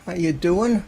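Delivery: big cheerful hello to the room; loud greeting. Real, not acted. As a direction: call out the welcome loudly. Greeting viewers at stream start.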